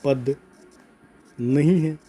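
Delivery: slow, spaced-out question reading; writing-along dictation. Real, not acted. Teacher writing out a question for students, slow dictation. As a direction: say each piece as you write it. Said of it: "उदाहरण लिख रहा हूँ".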